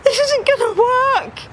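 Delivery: very high wheezy duck voice; wheezed. Acted. Dave sliding to Harry's feet after a fall, wheezing. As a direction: wheeze it out, winded from falling. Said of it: "he wheezed".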